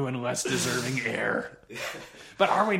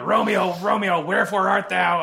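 high, loud mock Juliet cry; hammed-up Juliet calling for Romeo; 6 semitones higher, 7 dB louder than usual. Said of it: "when Juliet goes".

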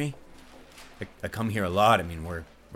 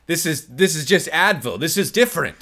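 loud defensive denial; 6 semitones higher, 8 dB louder than usual. Acted.

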